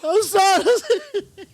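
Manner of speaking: high, loud, drawn-out laughing apology; helpless laughter. Real, not acted. Apologising to friends after own crude joke, laughing helplessly. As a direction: squeal it through real laughter.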